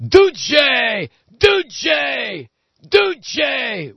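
high, shouted rally chant; drawn-out, high-pitched chanting yell; imitating screaming crowd. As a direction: bellow it like a frenzied crowd. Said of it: "started screaming".

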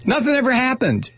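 high, sing-song sarcastic cheer; mock cheerful. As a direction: fake delight over the spill.

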